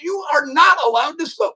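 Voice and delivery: very high, hammed-up scolding; high-pitched mock telling-off; mock mom yelling. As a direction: lay down the law as an imitated mom. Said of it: "they would continue to yell at me".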